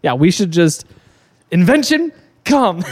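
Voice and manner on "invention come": mock announcer. Mock pitch slogan, joking.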